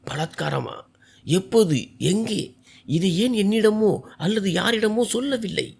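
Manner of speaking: suppressed furious questions, kept from carrying; hushed anger through gritted teeth. A narrator's reading. Husband holding back a shout so the house won't hear. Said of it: "பற்களை கடித்தபடி கத்தினான்".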